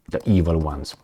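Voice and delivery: ordinary talk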